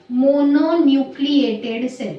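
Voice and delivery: mononucleated drawn out slowly and firmly; slow term dictation. Shown as a demonstration. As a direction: spell out the new term at dictation pace. Teacher introduces a new term.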